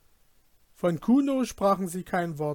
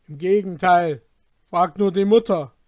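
angry retort; 10 dB louder than usual. Acted. Brothers quarrel, retort.

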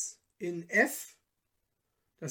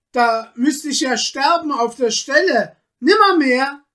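higher and louder, panicked; terrified refusal; 6 semitones higher, 10 dB louder than usual. Acted. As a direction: refuse in horror. Peter recoils in terror.

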